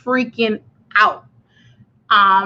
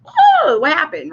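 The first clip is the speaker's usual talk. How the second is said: sudden high yelp of alarm; retold jolt of fright at a ringing ear; startled cry; 8 semitones higher, 4 dB louder than usual. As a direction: jump at the sound and squeak it out.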